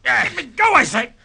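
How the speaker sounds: a high, loud, flustered cry to be let go; panicked protest. Acted. Shipowner caught in the captain's grip, in a shrill panicked protest. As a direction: squirm and demand release.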